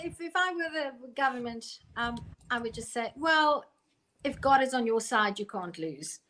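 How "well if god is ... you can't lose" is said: usual speaking voice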